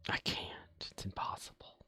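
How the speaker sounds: hushed, voiceless strained whisper. Pretend. Player resisting the greed within, whispering. Mock struggle against greed.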